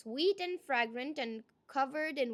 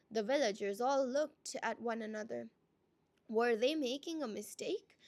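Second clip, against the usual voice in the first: ordinary delivery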